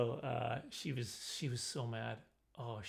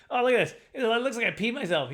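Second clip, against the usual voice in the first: joking; 7 semitones higher, 7 dB louder than usual. Retold or imitated. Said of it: "I was like".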